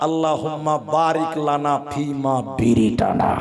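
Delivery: slow, measured recital, dropping low; mock prayer recitation. Preacher imitating a boy teaching him the bidi prayer, mock-solemn recitation. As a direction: intone it like a solemn Arabic dua, slowly.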